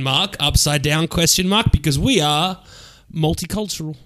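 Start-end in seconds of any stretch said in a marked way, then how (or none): none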